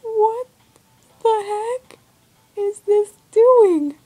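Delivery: high, incredulous and drawn out; baffled outcry. Real, not acted. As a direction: squeal it slowly in disbelief. Baffled by grisly game glitch.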